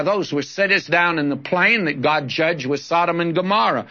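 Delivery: ordinary talk, nothing marked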